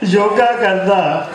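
slow, lilting mushaira delivery; poem recitation. Acted. Poet joking about his friend's yoga, lilting recitation. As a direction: draw out the couplet, phrase by phrase.

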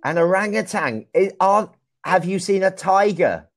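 ordinary talk, nothing marked

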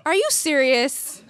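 slow, high, loud incredulous question; can't believe this is the problem; disbelief. Retold or imitated. Hearing the roommate's trivial worry, slow loud disbelief.

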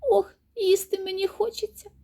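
high, hungry mouse voice; high-pitched squeaky complaint; squeaking mouse. A narrator's reading. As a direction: pipe it up like a little mouse. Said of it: "пищить Верть".

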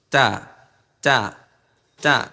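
isolated, carefully placed "তা"; model "তা" pronunciation. Shown as a demonstration. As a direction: separate "তা", tongue at the root of the teeth. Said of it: "আমার তা উচ্চারণটা খেয়াল করেন".

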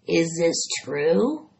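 slow, suspicious rat voice. A narrator's reading. Rat eyeing Charlotte's egg sac, suspicious. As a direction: squint at it and doubt. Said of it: "eyeing the sac suspiciously".

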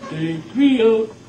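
high, slow, loud dummy voice; sing-song puppet squeak; squeaky ventriloquist dummy. Shown as a demonstration. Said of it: "that's a ventriloquist puppet".

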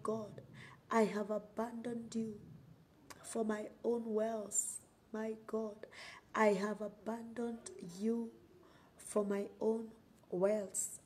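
normal talking voice

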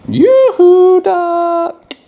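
high, loud yoo-hoo; teasing sing-song call. Acted. Parent as Froggy calling Duck, high and loud. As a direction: call out playfully across the yard.

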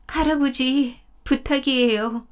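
high, imploring deer voice, begging grandfather; pleading deer. Acted. 덫에 걸린 사슴이 할아버지에게 애원하듯. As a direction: beg him to let you go.

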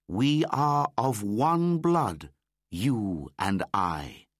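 put-on slow, proud child reciting; Mowgli. A narrator's reading. Mowgli recites the master words, slow and solemn. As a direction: chant it slowly like a solemn vow.

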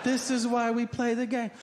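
high, exultant declaration; excited. Acted. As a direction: pitch it up like a thrilled player. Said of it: "excited to call".

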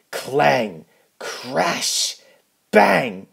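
crash noises. A narrator's reading. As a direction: slam each noise harder. Load falls before Spencer.

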